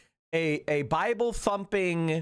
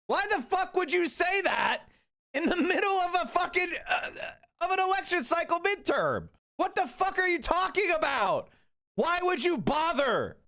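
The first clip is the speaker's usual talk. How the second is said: raised pitch, heated and incredulous; furious pitch-raised rant; angry rant; 9 semitones higher than usual. Real, not acted. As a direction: rant at the party's leaders, fed up. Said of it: "I'm tired of it".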